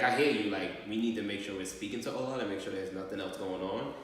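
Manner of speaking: ordinary talk, nothing marked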